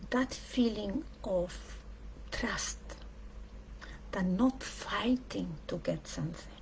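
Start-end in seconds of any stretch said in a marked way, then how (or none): none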